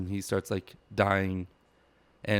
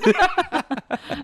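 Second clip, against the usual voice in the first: a burst of squealing laughter, much higher; 7 dB louder than usual. Real, not acted. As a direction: let out a high squeal of a laugh. Laughs at own joke.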